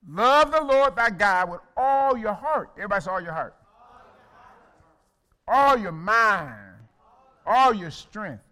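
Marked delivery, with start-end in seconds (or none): 0.1-2.7 slow, loud, ringing scripture; full-voiced sermon climax; preaching. Real, not acted. 5.5-8.4 loud, slow, every word pressed; loud pulpit emphasis; emphatic preaching. Real, not acted. Preacher driving home the commandment.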